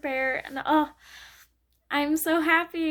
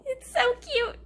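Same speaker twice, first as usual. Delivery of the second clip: much higher squealing half-sob, then cute; teary squeal. Real, not acted. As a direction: squeal with happy tears. Gushing about the bonus chapter.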